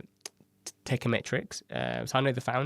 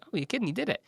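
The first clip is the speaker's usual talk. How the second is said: high delighted disbelief; pleasantly shocked realization; surprised; 8 semitones higher, faster than usual. Retold or imitated. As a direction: gasp it out amazed. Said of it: "And I was like".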